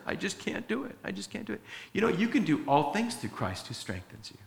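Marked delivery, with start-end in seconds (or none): 0.1-1.0 mock defeated plea. 1.0-1.6 fading defeated mutter. Pretend. Repeated plea fades out.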